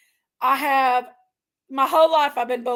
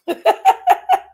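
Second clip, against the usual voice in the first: high, loud laugh; burst of laughter; 6 dB louder than usual. Real, not acted. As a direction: burst out laughing at your own outburst. Laughs at own outburst.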